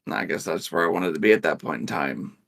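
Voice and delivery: ordinary talk, nothing marked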